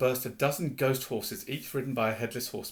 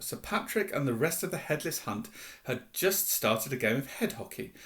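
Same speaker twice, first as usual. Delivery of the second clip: ordinary delivery, nothing marked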